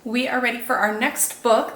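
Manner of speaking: ordinary delivery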